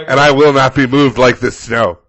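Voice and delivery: very loud, grand declaration; Gandalf-style resident. Acted. Resident standing off with shovel, booming declaration. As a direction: thunder it like a wizard with a staff. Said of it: "giving their best Gandalf".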